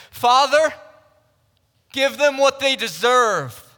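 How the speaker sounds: loud, high cry for vengeance; acted, forceful condemning shout; mock wrathful demand. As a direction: call out as if demanding punishment from heaven. Imagined vengeful cry from the cross.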